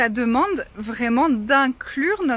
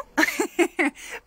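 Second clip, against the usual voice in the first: light, amused high giggle; high laugh; 10 semitones higher than usual. Real, not acted. Laughing about kids. (petit rire aigu)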